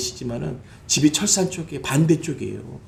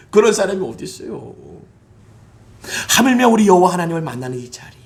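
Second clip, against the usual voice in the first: usual speaking voice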